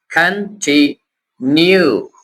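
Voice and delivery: Continue very slow, three separate pieces: kan, ti, nyu; broken into syllables. Shown as a demonstration. Teacher shows learners how to split big words, syllable by syllable. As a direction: pause between each syllable of continue. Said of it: "syllable बना लिया करे".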